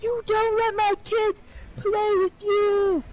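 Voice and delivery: high, drawn-out imitation of an irate dad; mock scream. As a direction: mimic a screaming father without full volume. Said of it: "screaming at the top of his lungs".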